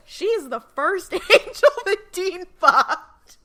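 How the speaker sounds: loud, slow laugh-through line; can't keep a straight face. Real, not acted. Laughing through scandalous remark.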